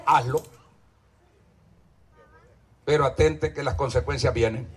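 low, soft ominous warning; quiet warning. Acted. Pastor warning the congregation softly of consequences, low and quiet. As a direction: drop the voice to warn. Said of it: "repeated warning".

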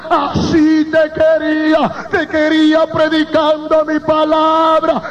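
slow, drawn-out impassioned testimony; preaching at full force. Acted. Preacher tells church his vision of heaven, impassioned preaching. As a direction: cry out the testimony in a sustained chant, vowels stretched.

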